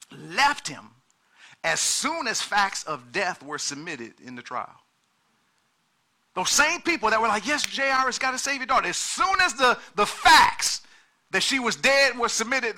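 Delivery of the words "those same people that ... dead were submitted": preaching at full force. Acted. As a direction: drive the point home to the church.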